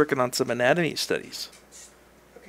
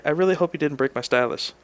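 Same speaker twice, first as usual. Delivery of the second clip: usual speaking voice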